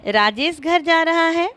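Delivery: "Rajesh" stressed, the sentence pitched high. Shown as a demonstration. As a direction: put the emphasis on "Rajesh" and keep the pitch up. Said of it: "पदों पर बल देकर बारी बारी से". ("राजेश" पर बल, ऊँचे सुर में)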